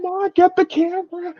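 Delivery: high squeaky mock sob; mock crying high voice. Friend mocking her tears in a high wail. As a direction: tease her with a crybaby wail.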